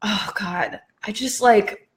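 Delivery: usual speaking voice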